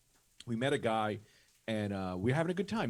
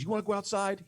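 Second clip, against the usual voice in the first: higher, challenging mock threat; retold threat; 7 semitones higher, 4 dB louder than usual. Neighbor threatens the bigger man, retold challenge. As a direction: dare him to fight, pitched up. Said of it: "He threatens him to go outside".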